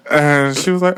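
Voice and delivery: normal talking voice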